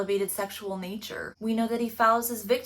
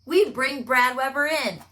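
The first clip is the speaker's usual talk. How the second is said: usual speaking voice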